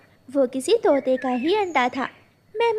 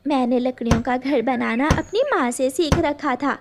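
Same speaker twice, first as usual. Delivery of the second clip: ordinary delivery, nothing marked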